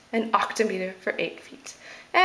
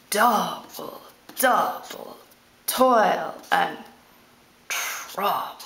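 "double, double" slow and low, each word stressed then falling away; trochee demonstration; 4 semitones lower, much slower than usual. Shown as a demonstration. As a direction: recite "toil and trouble" word by word, heavy first beats, long pauses. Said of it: "This is a trochee".

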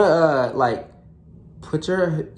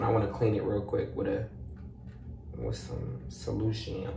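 ordinary talk, nothing marked

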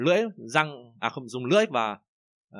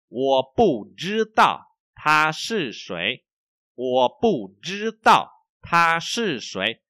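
slow, loud, clear Chinese to repeat after; slow model reading; 4 semitones higher, 7 dB louder, much slower than usual. Shown as a demonstration. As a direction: read it out slowly for students to echo.

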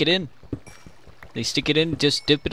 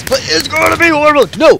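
panicked; 7 semitones higher, 5 dB louder than usual. Real, not acted. Panic while being chased.

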